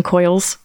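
"coils" for curls, vowel turned to oy; funny-voice "curls". Pretend. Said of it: "trying to say curls in a funny voice".

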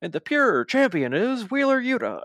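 high, loud hammed-up announcer call; mock ring announcer. Retelling the skit's announcer crowning Wheeler Yuta, hammed up high and loud. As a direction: boom it out like a silly ring announcer. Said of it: "the actual announcer in the background".

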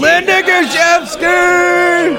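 stretched hype call; excited shout. Acted. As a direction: cheer the name like an announcer. Hyped shout-out for a super fan.

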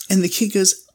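usual speaking voice

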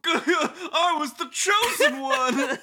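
very high, slow squeaky lament; mock tragic cartoon. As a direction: wail it in falsetto, half laughing.

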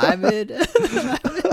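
squeaky laugh through the words. Real, not acted. Host cracking up at the story, laughing. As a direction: laugh it out high.

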